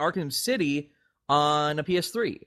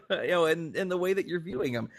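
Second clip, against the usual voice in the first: high exasperated mock voice; a flustered marketer correcting his boss; mock marketing exec; 8 semitones higher than usual. Marketing people correcting Miyamoto, high and exasperated. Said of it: "And it's like".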